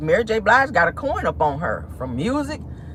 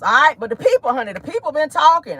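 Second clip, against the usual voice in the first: ordinary delivery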